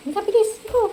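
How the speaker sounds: high-pitched, hurrying call to a pet; coaxing pet call. Real, not acted. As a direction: coax the pet along in a high voice. Coaxing pet to come along quickly. (malambing na pagtawag, mataas ang boses)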